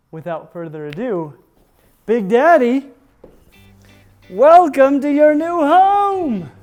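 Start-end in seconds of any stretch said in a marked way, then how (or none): 2.1-6.5 high, slow, grand reveal; gushing makeover reveal. Real, not acted. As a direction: announce it like a TV makeover show. Unveiling the enclosure to Big Daddy.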